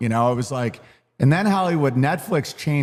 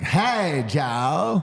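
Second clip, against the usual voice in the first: alien voice. Retold or imitated. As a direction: imitate the cartoon alien, lightly. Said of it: "He just sounds like the alien from American Dad".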